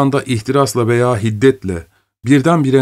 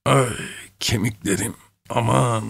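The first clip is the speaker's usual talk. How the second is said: put-on a softer old man's groan over aching bones; moaning with aches; 5 dB quieter than usual. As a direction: whimper it as if every bone hurts. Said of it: "inleyerek yatağına oturdu ve sızlanmaya başladı".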